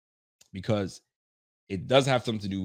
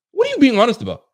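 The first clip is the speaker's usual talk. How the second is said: incredulous; 13 semitones higher, 10 dB louder than usual. Real, not acted. Mocking the quote, incredulous.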